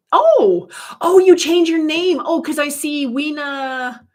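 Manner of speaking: the penny drops, loud and high; surprised realization. Real, not acted. Noticing a viewer changed their name, a high loud surprised oh.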